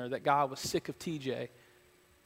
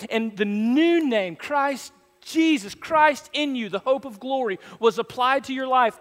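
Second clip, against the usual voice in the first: full-force preaching; 9 semitones higher, 5 dB louder than usual. Real, not acted. Preacher telling church what God has given, full-force preaching. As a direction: preach it high, punching key words loud.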